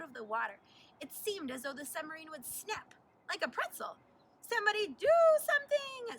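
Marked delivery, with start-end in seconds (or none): 4.4-6.2 much higher and louder panicked screech. A narrator's reading. Girl panics as the squid grips the sub, screeching. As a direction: shriek for help in panic. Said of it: "screeched Sue Ann".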